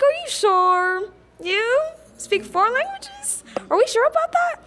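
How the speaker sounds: high, slow, incredulous mimicry of doubters; mock disbelief. Mimicking classmates who doubt she speaks four languages, mock incredulous. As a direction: stretch it out like you can't believe her.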